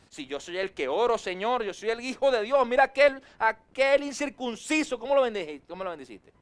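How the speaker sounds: preacher mocks a jealous believer's prayer; proud indignant complaint. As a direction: demand it like the wronged favourite son. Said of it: "concepto de sí mismo más alto".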